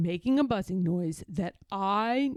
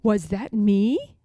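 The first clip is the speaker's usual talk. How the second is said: awed voice; 7 dB louder, slower than usual. A narrator's reading. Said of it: "in an awed voice".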